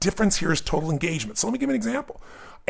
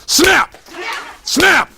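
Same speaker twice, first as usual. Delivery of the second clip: shouted high and loud; loud sharp snap; 11 semitones higher, 6 dB louder than usual. Shown as a demonstration. Coach shouting to trick the audience, high and loud. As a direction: jolt them out of the slump with a yell. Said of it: "Down, lack of state".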